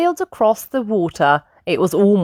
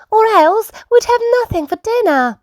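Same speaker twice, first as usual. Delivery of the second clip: very high relieved mum; Mummy Sheep. A narrator's reading. Mum thankful for dinner, very high character voice. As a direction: pitch up a grateful mother character. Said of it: "said Mummy Sheep".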